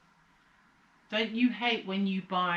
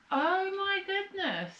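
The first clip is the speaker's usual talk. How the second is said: excited; 14 semitones higher than usual. Real, not acted. Delighted at opening package.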